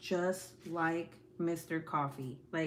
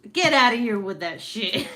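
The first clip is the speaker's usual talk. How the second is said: laughing shout; 4 semitones higher, 11 dB louder than usual. Real, not acted. Reacting to kid's nickname.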